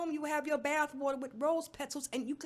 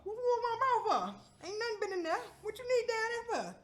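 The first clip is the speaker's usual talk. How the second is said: indignant disbelief; 10 semitones higher than usual. Retold or imitated. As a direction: protest through a laugh. Retold doctor visit.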